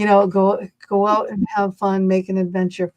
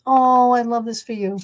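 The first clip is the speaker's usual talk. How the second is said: usual speaking voice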